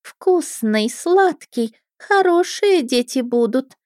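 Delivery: put-on high, drawn-out gloating girl; crazed mermaid. A narrator's reading. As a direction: savour each word, half mad. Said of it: "подняла на меня шальные, уже почти безумные глаза". (полубезумная юная русалка, нараспев)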